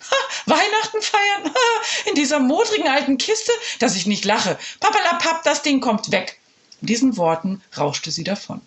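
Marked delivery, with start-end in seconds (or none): 0.1-6.4 high, scornful laughing principal; mocking laugh. Principal laughing at the children, high and scornful. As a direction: laugh them off with disdain. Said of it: "Frau Becker lachte".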